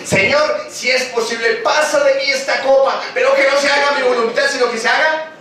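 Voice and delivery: súplica de Jesús. A narrator's reading. Jesús ora en Getsemaní.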